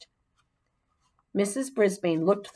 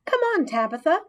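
put-on impatient kid, a bit pushy; 10 semitones higher, 3 dB louder, faster than usual. A narrator's reading. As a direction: hurry her up, exasperated. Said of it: "Mandy sounded impatient".

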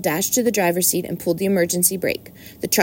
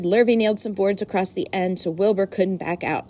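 ordinary delivery

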